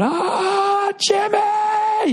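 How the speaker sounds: very high shouted Jimmy; imitating Ray Liotta's shower yell. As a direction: scream it like Goodfellas in the shower. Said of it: "Ray Liotta in the shower going".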